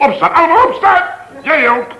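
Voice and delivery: high, loud, fast command; frantic order. Acted. Flustered professor ordering everyone to stand. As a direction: bark everyone up out of their seats.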